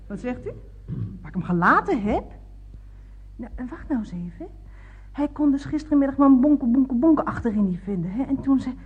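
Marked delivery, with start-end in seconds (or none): none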